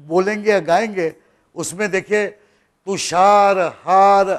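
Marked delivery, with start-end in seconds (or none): 2.9-4.3 तुषार हार with each आ held long; drawn-out long beats. Shown as a demonstration. Teacher showing how the poem is pronounced, long beats drawn out. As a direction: stretch the long vowels in तुषार हार, slowly. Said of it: "ऐसा उच्चार होता है".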